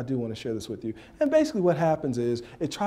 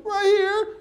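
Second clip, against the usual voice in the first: high, loud tearful whine; hammed-up sobbing child's reply; obey through mock tears; crying boy; 5 dB louder than usual. Crying boy shows the hurt spot.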